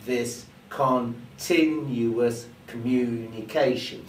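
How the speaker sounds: plodding, word by word with gaps; too slow. Shown as a demonstration. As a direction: drag out every syllable at a tedious crawl. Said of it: "becomes a bit like this".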